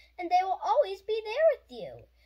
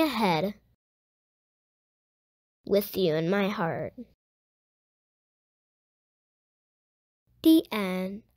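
put-on lower, slower read-aloud voice for a children's book; gentle storybook reading; 6 semitones lower, 8 dB louder, slower than usual. Host reading a grief picture book to young viewers, gentle and unhurried.

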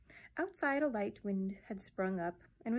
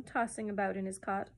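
usual speaking voice

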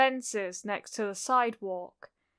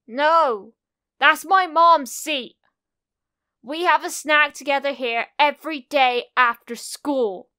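put-on loud, higher protesting child; angry shout; 5 semitones higher, 9 dB louder than usual. A narrator's reading. Kacchan shouts at Izuku over mom's seat, angry shout. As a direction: shout it like a possessive little boy. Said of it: "Kacchan shouted at him".

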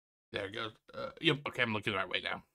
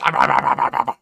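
breathless wheezing laugh; 6 dB louder than usual. Acted. Praying mantis joke laugh.

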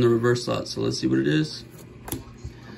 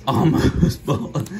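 laughing through Almost bald; slow laughing Almost bald; laughing repeat; much slower than usual. Real, not acted. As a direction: giggle while echoing the correction. Repeating son's correction.

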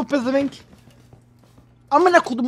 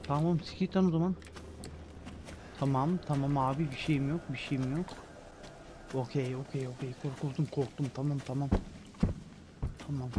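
much softer, lower nervous reassurance; scared and hushed; 10 semitones lower, 15 dB quieter than usual. Real, not acted. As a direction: keep it quiet so he won't hear. Scared under the bed.

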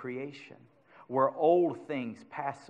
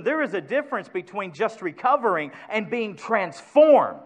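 ordinary delivery

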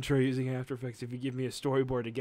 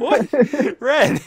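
high startled yelp; startled shout; 11 semitones higher, 15 dB louder than usual. Real, not acted. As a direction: cry out startled. Startled by bug.

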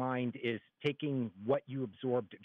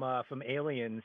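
ordinary delivery

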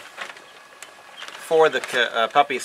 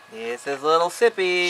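pet-talk voice; 4 semitones higher than usual. Real, not acted. Introducing the rescued cat.

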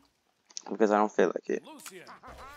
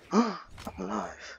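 breathless gasp, then a higher, slower, softer I'm alive; gasping relief; 10 semitones higher, 4 dB quieter, slower than usual. Real, not acted. Gamer gasps in disbelief at surviving the fight, breathless.

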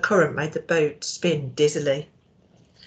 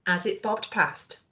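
ordinary delivery, nothing marked

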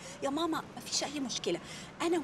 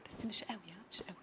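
much softer whispered plea; 10 semitones lower, 15 dB quieter, faster than usual. Acted. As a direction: whisper a careful request. Pleading softly not too harshly.

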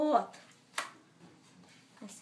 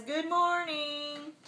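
Barney voice; 4 semitones higher, slower than usual. Acted. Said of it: "Barney says".

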